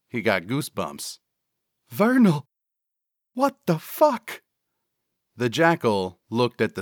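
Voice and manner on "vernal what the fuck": high-pitched sidekick panic; alarmed. Acted. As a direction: demand an explanation in a very high voice. Cecil frightened, confronts Vernal.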